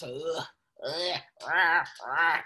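low growly dog grumble noises; imitated dog grumbling.

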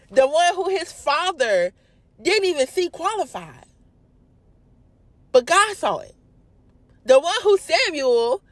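high, insistent build, phrase by phrase; impassioned high-pitched preaching; preaching fervor. Real, not acted. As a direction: press each clause high with rising conviction. Preacher builds emphatically on David's story.

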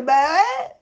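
lightly voiced reply; mock nurse. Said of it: "Ils lui ont fait".

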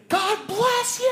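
very high, drawn-out screamed blessing; high-pitched mock scream. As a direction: scream it slowly, like a wife right in her husband's ear. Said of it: "she's screaming".

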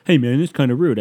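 a touch of a hurt stranger; mock offended guy. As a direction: play the offended commenter. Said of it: "commented back and being like".

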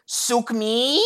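high rising "mi" in "Sukmi". Retold or imitated. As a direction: mimic him, stretching "mi" upward. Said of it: "with a raised inflection on the me".

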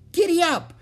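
put-on very high, loud child's call; excited little rider's shout; girl calling to horse. A narrator's reading. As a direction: squeal it out to spur the horse. Girl echoes the riding call.

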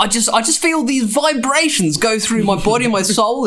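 high airy mock-spiritual voice; Russell Brand impression. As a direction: ham up a dreamy guru. Mocking Russell Brand's spiritual talk.

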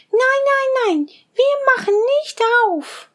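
put-on high, bleating kid-goat voices; little goat kids. Little goats promising their mother to be careful, high and bleating. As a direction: chirp it high like tiny goats.

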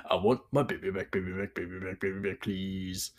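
put-on soft Scottish-accent chant, slowing at the end; Austin Powers' big dude. Imitating the film's big dude, soft Scottish accent. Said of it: "the big dude from Austin Powers".

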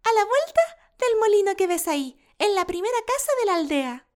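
put-on high, eager child voice; little girl voice. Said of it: "le contestó Caperucita".

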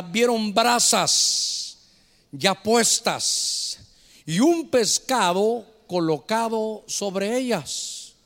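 very slow, weighty reading; slow scripture reading. Acted. Pastor reads John to the church, very slow. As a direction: draw each word out from the text.

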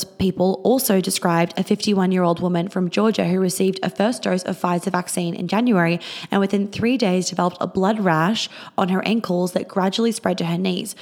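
ordinary delivery

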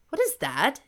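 lightly voiced emperor catching them out; lightly voiced startled emperor. A narrator's reading. Emperor spots the kissing.

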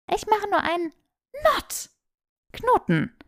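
put-on high, fast protesting young woman; a flustered girl caught out; flustered Hermine. A narrator's reading. Hermine, caught off guard by a test, high flustered protest.

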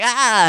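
high, loud answering yelp; imitated awkward "ah". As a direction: echo a buddy's awkward cry. Echoes friend's awkward cry.

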